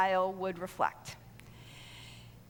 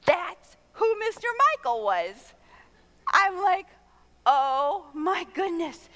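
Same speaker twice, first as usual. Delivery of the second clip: amazed reveal; 7 semitones higher, 7 dB louder, slower than usual. Real, not acted.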